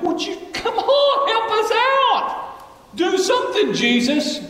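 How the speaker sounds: very high, slow, whining mock plea with a laugh; whiny disciples. Preacher mocking disciples nagging Jesus, high whiny laughing voice. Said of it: "they're like".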